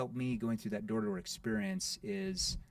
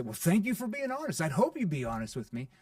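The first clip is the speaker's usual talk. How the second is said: playful; 4 semitones higher, 6 dB louder than usual. Shown as a demonstration. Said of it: "that does a couple things".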